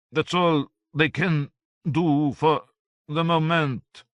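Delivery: the little philosopher calling out; Voltaire calling. A narrator's reading. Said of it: "the dwarf Voltaire called".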